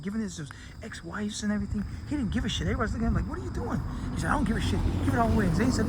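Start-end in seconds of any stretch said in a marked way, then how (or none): 3.3-3.8 high, incredulous question; baffled. Retold or imitated. Onlookers ask the man giving his fortune away, stunned. 4.3-5.5 careless shout. Retold or imitated. Careless giveaway.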